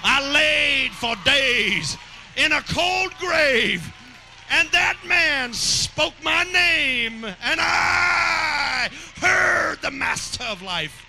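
high, slow, shouted testimony; Lazarus testifying. Acted. Lazarus telling the crowd of his raising, slow high shout. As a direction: proclaim it slowly from the grave. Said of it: "Who are you?".

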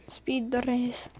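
slow, hushed mock Swedish accent. Shown as a demonstration. Gamer repeating spiders like PewDiePie, slow Swedish accent. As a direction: play with the word in mock Swedish. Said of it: "I can say that in a Swedish accent".

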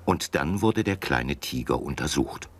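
ordinary delivery, nothing marked

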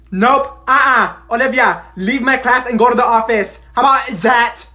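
loud, high dismissal of a pupil; angry teacher. Acted. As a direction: throw the pupil out, loudly fed up. Teacher throws pupil out of class.

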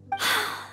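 a quiet let-down sigh; soft dejected sigh. Acted. Maid sighing to herself over failing, soft sigh. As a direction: breathe out the disappointment softly.

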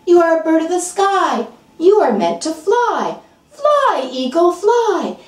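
rising, ringing urge to fly; rousing command. Acted. Friend urges the eagle to fly, rising excited call. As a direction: cheer the bird skyward.

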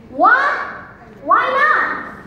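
high, loud burst of disbelief; indignant disbelief. Acted. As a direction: react in shock to being refused. Son reacts to being refused.

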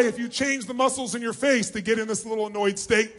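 ordinary talk

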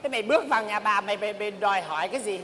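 ordinary talk, nothing marked

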